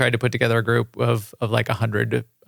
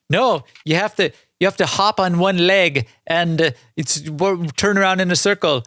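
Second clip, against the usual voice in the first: Osis adventurer voice; 5 semitones higher than usual. Retold or imitated. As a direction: play the smug rumor-spreading adventurer. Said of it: "Is that your Osis adventurer voice?".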